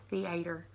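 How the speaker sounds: "theater" said softly as "thee-AY-ter"; emphasis on A. Shown as a demonstration. Vlogger explaining her emphasis, soft thee-AY-ter. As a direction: lean on a long A in theater. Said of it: "I put an emphasis on the A".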